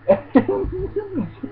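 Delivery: real high-pitched burst of laughter. Real, not acted. Narrator cracking up at the manga scene, high laugh.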